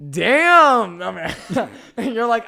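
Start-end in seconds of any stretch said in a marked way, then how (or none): 0.1-1.0 high, loud drawn-out exclamation; mock impressed. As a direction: belt it out in exaggerated admiration. Acting out his impressed reaction.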